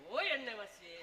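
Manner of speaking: low, drawn-out taunt; comic jeering call; teasing name-calling. Acted. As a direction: call the nickname mockingly.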